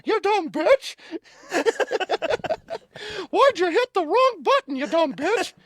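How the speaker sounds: very high, loud squeaky cartoon mouse voice; mocking an angry scammer in a Mickey Mouse voice. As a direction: shriek the insult like Mickey Mouse. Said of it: "your Mickey Mouse".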